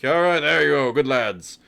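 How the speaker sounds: loud, high cheer; boisterous tavern approval; hearty patron. Acted. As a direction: call out heartily to the lads.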